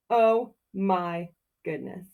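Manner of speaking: usual speaking voice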